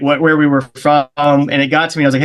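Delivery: normal talking voice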